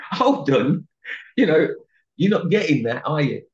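rising indignation at the crowd; retold indignant protest. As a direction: push back hard, retelling the moment. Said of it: "And you're like".

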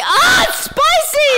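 loud, slow, burning-mouth yell; pained shout. Real, not acted. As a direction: shout as the heat hits.

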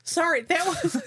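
laughing. Real, not acted. Caught laughing at own action.

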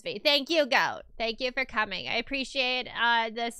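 usual speaking voice

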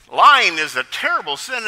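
loud, preachy pronouncement; mock sermon. Pastor preaching at boys on the sidewalk, loud mock sermon. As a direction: thunder it like an indignant pastor. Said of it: "he breaks into a sermon".

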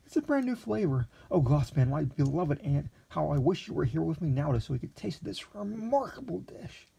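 put-on high, breathless young gourmet in raptures; a naive young man overwhelmed by a dish; eager young Lexington. A narrator's reading. Lexington marvelling at the meat, then calling the waiter, high.

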